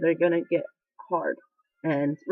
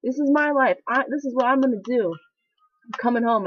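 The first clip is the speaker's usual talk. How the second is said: retold argument; 5 semitones higher than usual. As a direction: argue back hard, refusing to listen. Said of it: "I argued with her".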